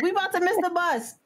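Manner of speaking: high-pitched fretting; panicky other tourists. Retold or imitated. Other tourists fret about the bus, high mock panic. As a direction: imitate flustered tourists, voice shot up. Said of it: "the other people like".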